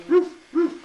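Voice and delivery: barking out roof roof like a dog. Pretend. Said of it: "You know what he said?".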